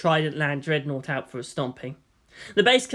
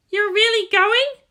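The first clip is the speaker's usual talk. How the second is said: put-on much higher, slow, arch girl's voice; 7 dB louder, slower than usual. Asuka questioning the departing Shinji, arch and high. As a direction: needle him with a raised brow. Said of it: "she asked archly".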